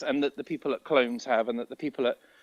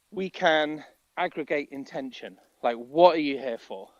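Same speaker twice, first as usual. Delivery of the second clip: ordinary delivery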